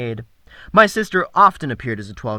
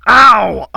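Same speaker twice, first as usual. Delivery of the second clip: pained yelp; 7 semitones higher, 13 dB louder than usual. Acted. Thug falls hard.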